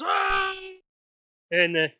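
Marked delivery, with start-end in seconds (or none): 0.0-0.5 high, sustained mock crowd "aah"; crowd's "ah". Game master as everybody reacting to the guess, high drawn-out aah. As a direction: imitate a whole audience going "ahh" up high. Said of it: "And everybody goes".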